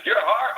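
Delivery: exasperated, stressing Your; exasperated emphasis. Real, not acted. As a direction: hit the word Your hard, fed up. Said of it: "What heart?".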